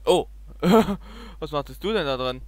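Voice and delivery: ordinary talk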